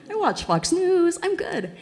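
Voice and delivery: high, breezy overconfident voice, laughing; cocky self-mockery. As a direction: act naively sure of yourself. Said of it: "I'm going".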